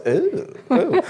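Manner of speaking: high-pitched teasing "ooh, ooh"; mock dominatrix oohs. Husband mimics wife's voice, high mocking oohs. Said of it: "that's the voice that goes".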